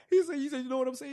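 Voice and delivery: high and loud, thrilled; excited. Real, not acted. Host relishing the Donovan line, excited. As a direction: burst with excitement.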